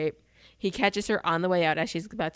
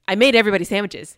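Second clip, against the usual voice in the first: much louder, pointed repeat; insistent repeat; 10 dB louder, faster than usual. Retold or imitated. Aunt repeating herself to ignoring guys, loud and insistent. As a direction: say it again so they finally listen. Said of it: "And nobody moves. And then she's like".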